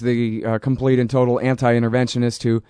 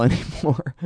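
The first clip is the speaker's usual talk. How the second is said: normal talking voice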